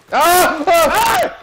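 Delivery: sharp high cry of pain; pained yell. Real, not acted. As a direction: yell out as you get hit. Gunfight, player shot.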